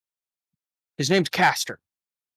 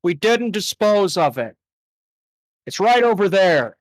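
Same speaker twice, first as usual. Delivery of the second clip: higher, pushy interruption; Yuri cutting in; 4 semitones higher than usual. Acted. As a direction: blurt it out from the back. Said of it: "Yuri in the back goes".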